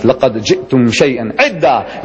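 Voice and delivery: quick fluent Arabic recitation of the verse; تلاوة سريعة; Quran recitation. Shown as a demonstration. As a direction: rattle off the Arabic verse fast, by heart. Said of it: "Allah says".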